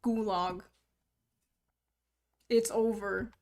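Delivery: ordinary talk, nothing marked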